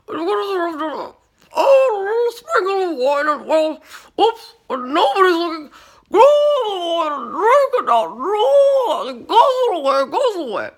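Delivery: very high, loud warble, a tipsy cook; giddy, trilling celebrity chef sneaking wine; Julia Child impression. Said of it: "I could just picture her".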